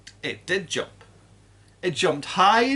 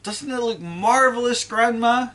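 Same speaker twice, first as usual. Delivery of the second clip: put-on higher, louder excited boy; boy shouting up to his grandma; excited yell; 10 semitones higher, 5 dB louder than usual. A narrator's reading. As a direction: call it out, thrilled. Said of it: "George shouted".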